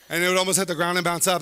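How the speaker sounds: ordinary talk, nothing marked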